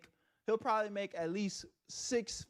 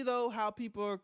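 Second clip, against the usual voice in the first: normal talking voice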